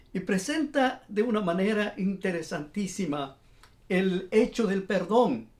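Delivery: usual speaking voice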